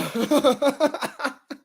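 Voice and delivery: high-pitched burst of giggling; high, rapid cackle; high laugh. Real, not acted. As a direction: laugh helplessly at a bad play. Laughs at the bad play.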